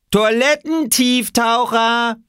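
high, loud, drawn-out taunt; jeering call. Acted. Small boy insulting swimmers from the shore, jeering shout. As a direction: holler the insult, stretching it out.

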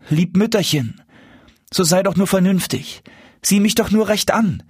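high-voiced, coaxing appeal; pleading. A narrator's reading. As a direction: beg your mother to see reason. Son begs mother to recognise him. (inständig bittend, mit hoher Stimme)

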